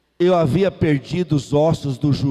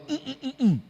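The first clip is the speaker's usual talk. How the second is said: refusing hum; 7 semitones higher, 6 dB quieter, much faster than usual. Retold or imitated.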